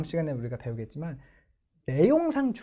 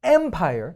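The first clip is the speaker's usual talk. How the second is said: Empire with the first syllable EM stressed and louder; 5 dB louder than usual. Shown as a demonstration. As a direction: hit EM in Empire. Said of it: "보세요".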